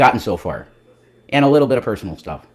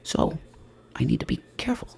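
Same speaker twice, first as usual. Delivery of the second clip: whisper; 7 semitones higher, 7 dB quieter than usual. Pretend. Continuing the innocent act.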